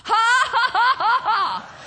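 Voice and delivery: high forced ha-ha laugh; demonstrated class laugh. Shown as a demonstration. Preacher as laughter aerobics trainer, demonstrated laugh. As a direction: show them how to laugh on cue. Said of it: "All you gotta do".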